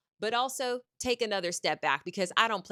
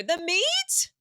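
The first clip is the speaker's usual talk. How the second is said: incredulous; 13 semitones higher, 5 dB louder than usual. Real, not acted. Outraged rhetorical question.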